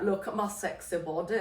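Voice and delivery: soft put-on Scottish accent. Teasing Patrick after he objects, in a Scottish accent. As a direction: playfully fake a Scottish accent. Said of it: "I keep doing this Scottish accent".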